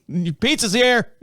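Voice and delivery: a mock sing-song call that the pizza is here; dad calling out. Dad voiced announcing pizza.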